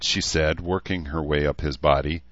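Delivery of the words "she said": plain reading voice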